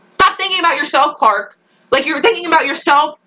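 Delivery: high-pitched exasperated telling-off; exasperated scolding. Real, not acted. Viewer telling Clark off, exasperated scolding. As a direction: scold the character as if she can hear.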